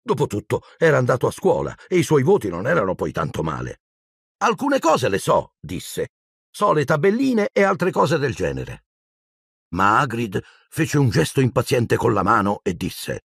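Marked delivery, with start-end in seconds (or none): 4.4-5.5 put-on much higher-pitched boy's voice; a child's slightly hurt protest; boy defending himself. A narrator's reading. 6.5-8.9 put-on high-pitched child's voice; much higher boyish protest; boy defending himself. A narrator's reading.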